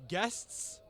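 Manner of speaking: surprised high "Guests?" from the captain; questioning captain. Acted. Captain points to strangers at the table, puzzled. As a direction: ask it like a puzzled host.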